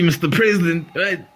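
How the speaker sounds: ordinary talk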